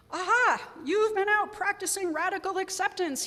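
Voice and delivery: spring it on her like a gleeful insight; knowing exclamation. Retold or imitated. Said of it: "with the wisdom of someone who knows".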